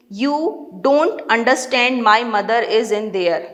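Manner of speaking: ordinary delivery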